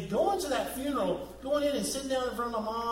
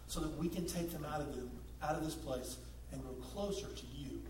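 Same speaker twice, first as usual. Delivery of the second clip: soft, low, hushed prayer; gentle pastor's prayer; 7 semitones lower, 9 dB quieter than usual. Acted. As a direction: pray quietly over the room. Leads congregation in prayer.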